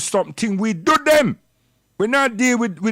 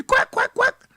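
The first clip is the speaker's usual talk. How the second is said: squeaky high mocking quack; high mocking squeak, playing the chicken they call him. Mocking those who call him a chicken, squeaky high quack. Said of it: "I'm a chicken".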